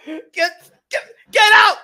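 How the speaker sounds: explosive comic order to leave; mock shout. Man ordering her off the luggage, high mock shout.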